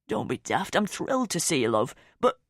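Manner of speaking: a hint of a kindly dad; reassuring dad. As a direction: brush off the apology like a fond dad.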